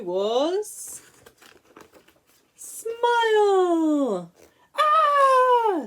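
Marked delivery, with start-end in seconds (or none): 4.7-5.8 loud, high startled scream; sudden frightened yelp; fisherman's high scream. Acted. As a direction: shriek at the sight of the shark. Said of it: "screamed the fisherman".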